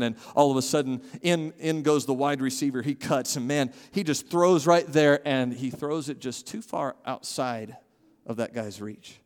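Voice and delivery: ordinary talk, nothing marked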